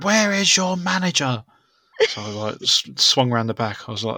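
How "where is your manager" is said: angry customer. Retold or imitated.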